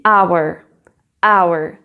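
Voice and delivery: "hour" said as our, no h sound; slow model of "hour" starting on a vowel; English "hour" with silent h. Shown as a demonstration. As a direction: drop the h in "hour". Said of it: "tu ne le prononces pas".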